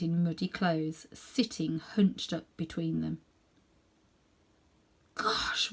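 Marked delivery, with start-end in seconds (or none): 5.1-5.7 breathless whispered gosh; startled boy's whisper. Acted. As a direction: gasp it under your breath. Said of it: "whispered Diggory".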